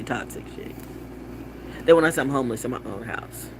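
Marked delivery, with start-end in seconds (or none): 0.0-0.7 softer, unvoiced toxic chick; whispered aside. Real, not acted. Mocking what critics call her. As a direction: whisper the insult sarcastically.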